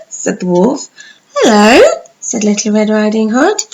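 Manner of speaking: bright, lively storybook narration; animated storytelling voice; reading to children. Acted. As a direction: tell it like bedtime story for little ones.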